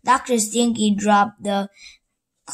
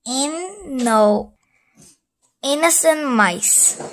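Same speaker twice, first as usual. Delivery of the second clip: ordinary delivery, nothing marked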